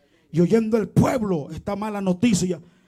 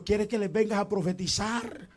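normal talking voice

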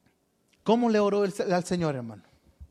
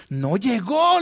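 mock-indignant, loud and higher; acted reproach; 4 semitones higher, 6 dB louder, much slower than usual. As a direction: imitate someone complaining at being stood up. Woman reproaches him for not coming.

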